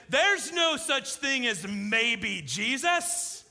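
bristling at a sales pitch; indignant quote. Retold or imitated. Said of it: "He threw his hands up".

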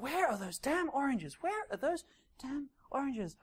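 soft, slow mock mutter under the breath; a shopper grumbling to himself, slowly searching. Shopper hunting for oranges, slow soft mock mutter. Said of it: "you're like".

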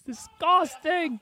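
high, drawn-out mock heckle; imitated yell. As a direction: mimic a heckler's yell, stretched out without full volume. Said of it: "And he yells again".

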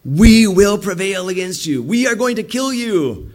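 slow, loud, high mock threat; menacing enemies. Enemies threatening to kill Jeremiah, theatrical menace. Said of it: "So they're like".

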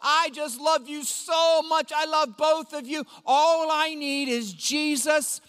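put-on high, slow, adoring child voice; sweet little boy. Five-year-old son hugging his parents, high and slow. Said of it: "and he'll go".

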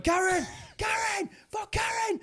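high hammed-up shouting of a name; Coronation Street wail. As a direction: scream the name in melodramatic despair. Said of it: "like Coronation Street style".

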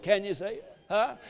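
ordinary talk, nothing marked

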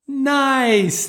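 put-on high, long-drawn kids' chorus; children in unison. Small children answering the instructor together, high drawn-out chorus. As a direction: sing it out high like a whole class. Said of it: "Everyone in unison says".

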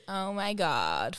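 slow, drawled mock LA; LA girl. Recalling the friend repeating it, LA drawl. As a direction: drag it out like an LA girl. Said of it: "That was what she said".

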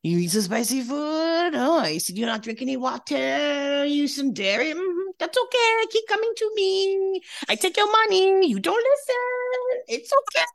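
very high, hammed-up French accent; [name]'s French accent. Facialist chiding then forgiving her client, mock French accent. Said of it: "This is my French accent".

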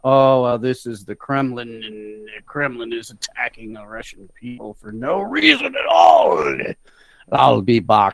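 shouty mocking voice of a ranting guy; hammed-up rant with a low put-on voice; mocking impersonation. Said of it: "spewing on about".

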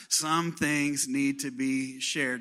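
slow, drawn-out congregation line; slow call-and-response. Shown as a demonstration. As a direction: stretch it out for everyone to repeat. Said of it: "So everybody say it out loud".

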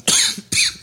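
dry cough; real coughing. Real, not acted. Coughing after smoking.

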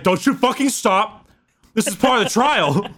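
high-pitched, mock-commanding taskmaster; stern trial master. Primarch overseeing an aspirant's trial, mock commanding. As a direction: drive a flagging recruit on, hammed up. Acted trainer urging recruit through pain.

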